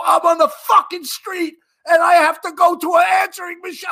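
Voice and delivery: high, loud furious yell down the phone; retold screaming. Cop screaming at a headquarters clerk over the phone, furious yell. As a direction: scream it at the useless desk guy. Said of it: "And I scream at the guy".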